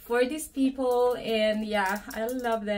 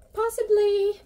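high hopeful; 11 semitones higher, 8 dB louder than usual. Real, not acted. Hopeful about the phone fitting.

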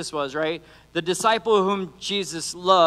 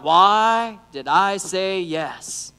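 drawn-out regret; much slower than usual. Retold or imitated. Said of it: "they have thought".

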